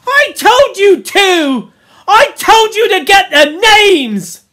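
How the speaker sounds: high, slow, loud shout; angry accusation. A narrator's reading. Ralph blames Piggy over the names, angry shouting. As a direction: bellow the blame, stretching the words.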